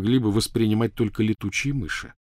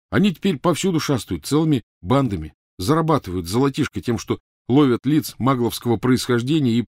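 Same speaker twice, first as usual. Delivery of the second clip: put-on higher, animated young man's account; lively explaining, pitched up from narration; Ron, eager storyteller; 5 semitones higher, 4 dB louder than usual. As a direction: recount the misadventure energetically. Ron tells his story.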